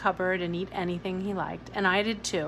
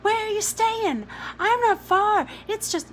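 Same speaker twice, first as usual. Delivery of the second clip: put-on much higher eager child voice; excited boy; 12 semitones higher, 5 dB louder than usual. A narrator's reading. Jamie to Billy's family, high and excited. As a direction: bubble over like a small boy.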